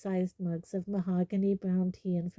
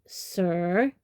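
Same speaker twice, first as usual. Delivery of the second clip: "sir" set apart and louder; stress on SIR; 5 dB louder than usual. A narrator's reading. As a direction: lean pointedly on "sir". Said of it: "emphasizing the last word".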